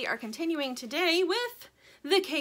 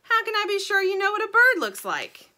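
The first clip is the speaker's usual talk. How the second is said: higher, louder demand; challenging chicken; 11 semitones higher, 9 dB louder than usual. Acted. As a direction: press the bird hard. Sugar challenging, loud.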